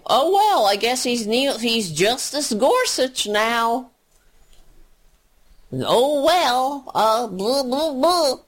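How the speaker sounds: high, dismissive shrugging voice, trailing into blah blah; mocking meek shrug. Radio host mocking Democrats who accept Gorsuch, high dismissive shrug. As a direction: play a limp, resigned Democrat brushing it off.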